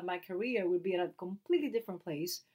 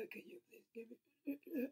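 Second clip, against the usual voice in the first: feeble, whispered plea of a mock invalid; mock dying whisper; 3 semitones higher, 14 dB quieter than usual. Imitating husband asking wife for help, dying whisper. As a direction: whisper weakly as if on your deathbed. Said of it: "talk to me like you're dying".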